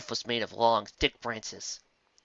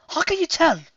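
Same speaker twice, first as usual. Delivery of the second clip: high, louder questioning voice; curious pony; 7 dB louder than usual. Acted. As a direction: ask in disbelief. Another pony asks, surprised.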